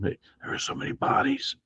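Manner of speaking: soft, voiceless stage whisper; hushed aside, all breath. Acted. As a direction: whisper it secretly to a companion. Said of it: "Sparky probably whispered some".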